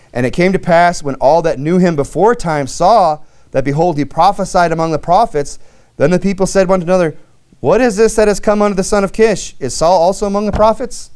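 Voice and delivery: scripture reading. Acted. Preacher reads the people's astonished question aloud, emphatic scripture reading. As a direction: read the verse out to a congregation.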